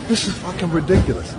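ordinary talk

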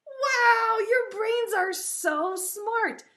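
high, drawn-out wow; excited praise. Pretend. Teacher praising preschoolers' brains, thrilled. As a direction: gush with amazement, high and slow.

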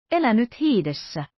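a touch of alarm in the outburst; uneasy exclamation. A narrator's reading. D'Artagnan alarmed at Athos's bet, uneasy exclamation. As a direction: blurt it out, a little uneasy. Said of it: "huudahti D'Artagnan levottomana".

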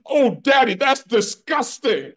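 put-on very high, loud child's squeal; mock child squealing in disgust; son's voice. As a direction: squeal it like a disgusted little kid. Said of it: "my son says".

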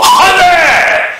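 high, loud sudden yell; screeching involuntary curse; shouted swear. Pretend. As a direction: blurt it out uncontrollably. Mock tic outbursts of swearing.

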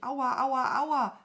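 high, loud whimper; hammed-up "ow" wail. A narrator's reading. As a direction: moan theatrically in pain. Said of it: "wimmerte sie".

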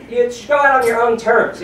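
ordinary talk, nothing marked

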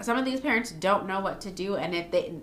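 ordinary delivery

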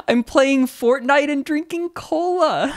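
put-on high, slow squeaky child quote; imitated little kid. Translating the boy's boast for viewers, imitated kid voice. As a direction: draw it out like a gleeful kid.

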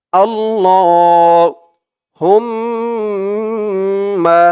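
আল্লাহুম্মা slowed with the humm held in the nose; nasal ghunnah. Shown as a demonstration. Teacher showing the ghunnah, very slow. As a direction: hum through the mm of আল্লাহুম্মা. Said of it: "বলে গুন্নাহ করতে হবে".

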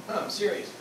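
usual speaking voice

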